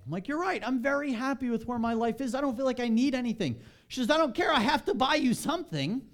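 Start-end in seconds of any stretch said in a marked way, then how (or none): 0.3-3.6 high, bright protest that he needs nothing; talking his wife out of buying him a gift, upbeat and high; cheerful insistence. Retold or imitated. Husband brushing off his wife's gift plan, pitched up. Arguing with wife over gifts. 4.1-6.1 high, louder mock-insistent wife. Wife overruling her husband, high and pushy.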